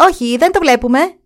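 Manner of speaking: bewildered courtiers reply; courtiers answering. Acted. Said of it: "απάντησαν εκείνοι".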